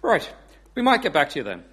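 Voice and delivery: Irish caller backing off; reply in an Irish accent. Acted. Paddy after hearing Kim's numbers, Irish accent. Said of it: "I do do an Irish accent".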